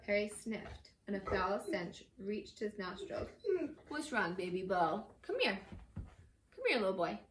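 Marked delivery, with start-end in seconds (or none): 3.9-7.2 coaxing pet talk. Real, not acted. Reader calls to their dog.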